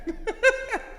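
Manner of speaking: sudden high laugh, real amusement; high burst of laughter. Real, not acted. Laughs at incomprehensible verse.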